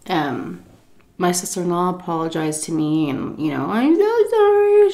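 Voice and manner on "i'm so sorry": high, whiny mock-tearful voice; acted-out tearful sorry; imitated crying apology. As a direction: mimic a weepy apology, pitched way up. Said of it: "She was crying".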